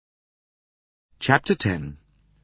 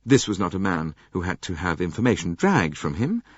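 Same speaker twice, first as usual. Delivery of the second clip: ordinary delivery, nothing marked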